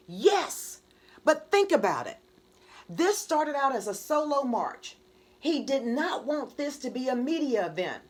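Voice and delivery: high, loud pressing argument; heated argument. Acted. Marcher arguing to keep the march small, heated.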